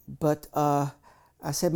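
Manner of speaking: ordinary delivery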